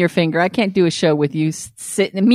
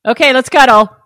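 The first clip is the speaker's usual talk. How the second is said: mock orders, pushed louder and higher; imitated bossy demands; 4 semitones higher, 6 dB louder than usual. Co-host mimics Mew ordering partner around, bossy. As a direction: imitate someone calling all the shots. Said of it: "calling all the shots".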